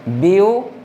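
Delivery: View alone, lifted at the end; view held up as one separate beat; single syllable isolated. Shown as a demonstration. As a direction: sound out just the first syllable view. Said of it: "एक बार में हम पढ़ रहे हैं ब्यू".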